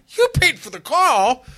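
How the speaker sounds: mother's voice an octave up, very loud; voice shot up. Retold or imitated. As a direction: imitate a stunned mother shrieking in disbelief. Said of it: "her voice shot up an octave".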